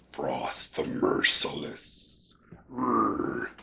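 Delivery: deep, slow whispered growl of a merciless beast; menacing bear voice. Pretend. Voicing a toy bear figure, a slow menacing growl. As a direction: growl the name like a frost monster.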